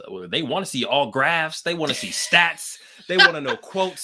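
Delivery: high, excited know-it-all voice; nerd voice. Acted. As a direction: play a stats-obsessed nerd. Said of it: "The way your voice changed ... nerd voice".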